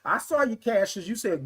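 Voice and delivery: ordinary talk, nothing marked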